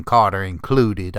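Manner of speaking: ordinary delivery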